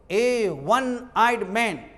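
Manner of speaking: slow, deliberate phrase, word by word; slow teaching emphasis. Shown as a demonstration. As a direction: lay the phrase out slowly for the class. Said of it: "जैसे मान लो एक आंख दिखता है". (धीरे-धीरे, ज़ोर देकर समझाना)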